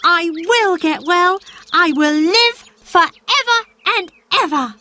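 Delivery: put-on a frail boy promising himself he'll live; sickly boy Colin. Colin's voice, child figure.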